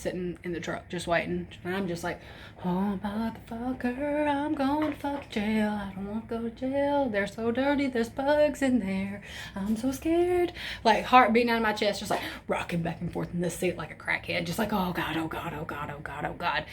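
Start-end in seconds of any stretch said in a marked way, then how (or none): 2.6-10.5 soft, slow, higher whimpering fear; mock-frightened softly to herself; hammed-up quiet scared whine. Herself scared waiting in truck. 14.7-16.7 low, much softer frantic muttering; mock panic under the breath; low rapid oh God repeated softly; panicked muttering. Rocking in seat, panicking.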